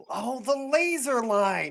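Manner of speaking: high, drawn-out eureka cry; sudden realization. Acted. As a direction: draw it out as the idea dawns. Acts out sudden realization, snapping fingers.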